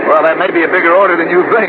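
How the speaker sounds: shouting over rain. Acted. Shouting over storm to Rudy.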